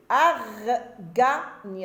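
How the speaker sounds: "arganier" slowly split as ar, ga, nier; syllable by syllable. Shown as a demonstration. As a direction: draw out each syllable of "arganier" with gaps between. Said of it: "Alors la première syllabe, j'entends ar".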